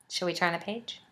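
ordinary delivery

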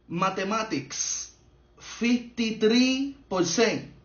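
drawn-out, deliberate delivery of each word; slow, pointed reading. Acted. Father reading his child's maths mark, slow and pointed. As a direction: read the grade out very slowly. Father reads out a bad grade.

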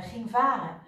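ordinary delivery, nothing marked

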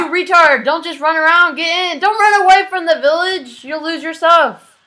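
high, loud scolding shouts; frantic yelling. Real, not acted. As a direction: yell orders at a panicking friend. Scolding friend during attack.

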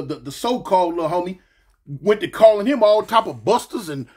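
normal talking voice

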